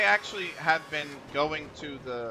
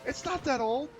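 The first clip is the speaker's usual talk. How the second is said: mock indignant reply; 13 semitones higher than usual. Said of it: "Adam turns around and goes".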